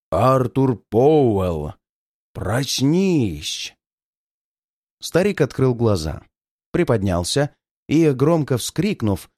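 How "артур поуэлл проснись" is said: slow, hollow ghost voice from the grave; hollow sepulchral voice. Acted. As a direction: drag the words out like a spirit. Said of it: "сказала глухим, замогильным голосом".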